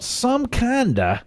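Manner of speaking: Southern "some kinda", slow with a long "kinda"; southern drawl. Shown as a demonstration. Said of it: "that'd be that southern version of kind".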